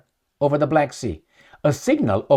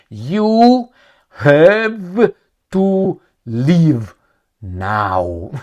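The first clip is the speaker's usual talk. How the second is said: put-on very slow Russian-accented order; mock Russian accent; 6 dB louder, much slower than usual. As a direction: stretch each word in a heavy Russian accent. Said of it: "Sounds like Russian".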